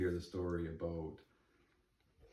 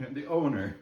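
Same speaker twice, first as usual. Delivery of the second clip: high, loud laughing blurt; 5 semitones higher, 6 dB louder than usual. Real, not acted. Storyteller caught without the man's name, laughing. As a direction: laugh while getting it out.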